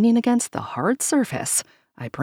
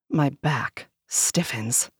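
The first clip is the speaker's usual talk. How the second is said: plain reading voice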